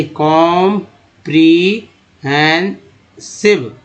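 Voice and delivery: Comprehensive very slowly, com-pre-hen-sive; syllable by syllable. Shown as a demonstration. Teacher building the word from parts, comprehensive in slow chunks. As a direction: stretch and separate each part of comprehensive. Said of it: "SI को सिव बोलते हैं".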